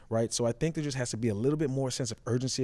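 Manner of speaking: ordinary talk, nothing marked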